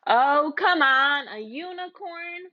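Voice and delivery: drawn out, loud then dropping away; exasperated disbelief. Acted. The storyteller groaning at a unicorn, incredulous. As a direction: scoff at the silly unicorn.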